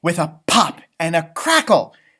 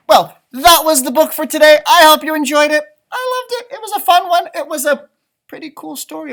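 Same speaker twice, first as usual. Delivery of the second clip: cheery sign-off; 11 semitones higher, 11 dB louder than usual. Real, not acted.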